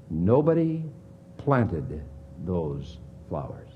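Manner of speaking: preacher's hushed emphasis; emphatic. Acted. Preacher stressing God's creation.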